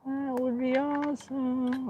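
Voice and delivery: very high, slow falsetto; drawn-out high echo, playfully wishful. Pretend. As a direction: stretch it out in a silly high sing-song.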